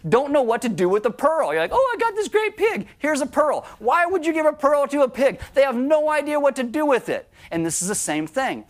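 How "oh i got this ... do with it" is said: high, hammed-up proud pig owner; mock pig owner. Mocking the fool who hands a pearl to a pig, high and silly. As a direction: squeal it out like a silly fool showing off his pig.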